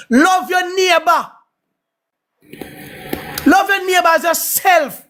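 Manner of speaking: high, drawn-out scripture declaration; preachy proclamation. Real, not acted. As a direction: proclaim the commandment like a street preacher.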